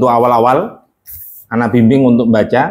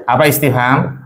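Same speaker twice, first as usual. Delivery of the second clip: usual speaking voice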